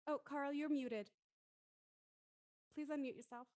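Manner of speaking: usual speaking voice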